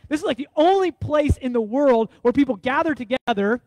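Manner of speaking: ordinary delivery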